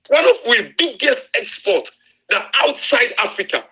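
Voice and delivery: high, pleading outcry with a break in it; impassioned rant. Real, not acted. Host pleading to listeners about Africa's resources, impassioned. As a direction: rail against the waste, almost crying out.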